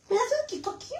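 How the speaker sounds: high soft cooing ask. Real, not acted. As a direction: coo softly for a kiss. Asking pet or child for kiss.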